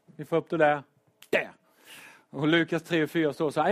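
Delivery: normal talking voice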